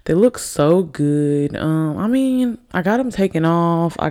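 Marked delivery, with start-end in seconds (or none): none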